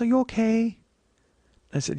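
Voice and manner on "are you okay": high, small woman's voice; concerned woman. Retold or imitated. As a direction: imitate her checking gently, pitched up. Acts out the woman asking gently.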